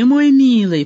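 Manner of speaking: loud mocking "мой милый"; sarcastic endearment. A narrator's reading. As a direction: spit the endearment out as mockery. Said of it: "подбадривал его Вадим".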